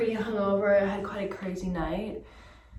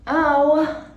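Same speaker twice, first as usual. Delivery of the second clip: loud, high yelp; pained shouting; 8 semitones higher, 10 dB louder, slower than usual. Real, not acted. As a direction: cry out loudly in pain. Cries out in pain.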